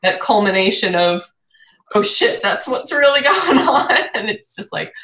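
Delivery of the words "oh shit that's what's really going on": higher-pitched stunned discovery with a laugh; mock shocked realization, breaking into laughter; acted realization. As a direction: play the penny dropping, amused. Acts out the moment of realization.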